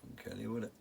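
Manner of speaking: usual speaking voice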